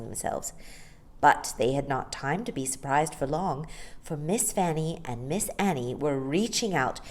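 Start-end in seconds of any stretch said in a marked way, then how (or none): none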